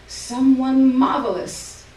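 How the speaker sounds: slow, loud, high and grand; an old mother proudly telling her daughter who she is; old Ma's voice. A narrator's reading. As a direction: savour each word like an old diva.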